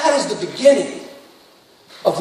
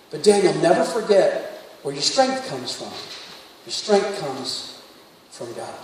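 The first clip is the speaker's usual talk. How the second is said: dad's earnest note; 7 semitones lower, 8 dB quieter than usual. Retold or imitated. Reading dad's note aloud.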